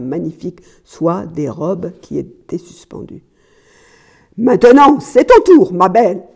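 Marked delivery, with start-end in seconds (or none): 4.4-6.2 high, loud, threatening shout; furious yell. A narrator's reading. Husband threatening his disobedient wife, yelling. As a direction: bellow the threat at her. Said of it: "hurla-t-il".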